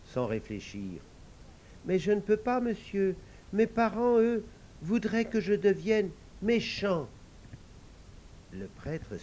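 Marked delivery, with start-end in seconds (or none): 1.8-7.1 little devil. Acted.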